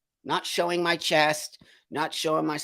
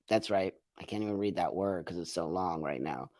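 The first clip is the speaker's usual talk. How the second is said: usual speaking voice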